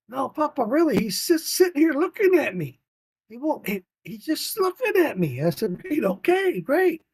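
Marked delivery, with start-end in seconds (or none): 0.1-5.4 put-on much higher, pleading child voice; insistent little girl. Granddaughter insisting to grandpa about the fish, pleading child. 5.8-7.0 much higher, sing-song humoring voice. Retold or imitated. Grandpa humoring granddaughter, sing-song dismissive.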